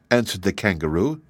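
plain reading voice

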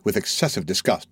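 plain reading voice

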